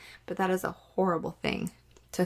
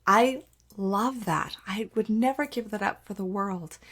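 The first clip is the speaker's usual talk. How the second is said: sincere whisper; 2 semitones higher than usual. Real, not acted. Mom admitting how much she loves being there, whispered. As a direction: whisper it like a precious secret. Sincere confession about motherhood.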